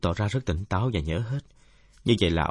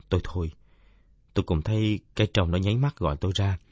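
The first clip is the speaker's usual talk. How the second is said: ordinary delivery, nothing marked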